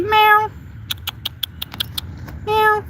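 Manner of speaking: squeaky imitated meow; playful cat meow; high meow. Meowing at the cat.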